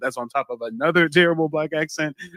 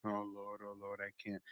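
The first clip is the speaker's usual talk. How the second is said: very soft, slightly lower aside; hushed exasperation; 3 semitones lower, 21 dB quieter than usual. Real, not acted. Host reacting to the grammar lesson, very quiet exasperation. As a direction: murmur it as if you can't take it. Host overcome after watching clip.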